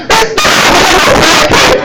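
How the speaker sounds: high, loud relieved shout; crying out. Retold or imitated. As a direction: yell it across the parking lot. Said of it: "I'm crying out".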